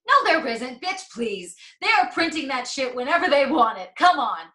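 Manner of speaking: loud, exasperated pushback; brash, punchy dismissal; emphatic scoffing rebuttal. Acted. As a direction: shoot the idea down hard. Loudly rebuts the scarcity belief.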